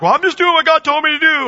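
hammed-up, high-pitched excuse; mock excuse-maker. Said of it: "and they're like".

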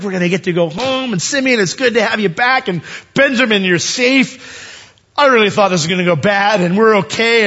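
high, animated relief; relieved chatter. Acted. Brothers heading home with Benjamin safe, high and relieved.

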